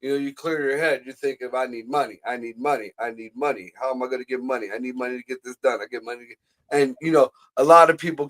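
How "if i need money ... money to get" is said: low, soft, inward repetition; hushed, low self-talk; murmured money mantra. Acted. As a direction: run it like a private mantra under your breath. Voicing thoughts while clearing head.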